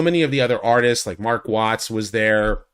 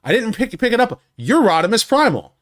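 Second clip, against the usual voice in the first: much higher, eager recognition; imitating the writer's sudden delight at spotting a fan; high excited mock recognition; excited retold quote; 11 semitones higher, 4 dB louder than usual. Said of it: "and he goes".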